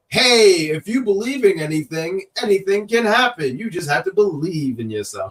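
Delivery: high, earnest hero pep talk, hammed up; over-the-top babyface. Wrestler playing hero to the crowd, over-the-top babyface. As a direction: imitate a gushing good-guy motivator, easing down at the end. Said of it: "too over-the-top babyface".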